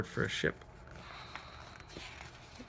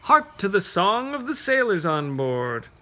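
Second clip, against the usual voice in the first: higher, sing-song verse building to the sailors; reading a poem to children; 5 semitones higher, 5 dB louder than usual. Acted. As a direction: paint the harbor for the kids.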